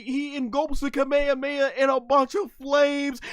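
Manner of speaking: high, loud excited shouting; hyped. Real, not acted. Fan hyping the flame Kamehameha, loud excited. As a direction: rave about it at the top of your voice. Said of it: "and I'm like".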